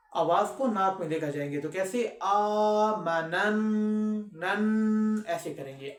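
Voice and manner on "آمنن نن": آمنن with the nn held in the nose; nasal ghunnah. Shown as a demonstration. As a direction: hum the نن through the nose, slowly. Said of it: "خشون کرتے ہیں".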